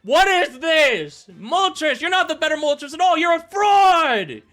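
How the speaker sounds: high, loud screaming accusations; ranting scream, last word drawn out; outraged yell. Real, not acted. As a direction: denounce your teammate at full volume. Yelling accusations at the Pokémon.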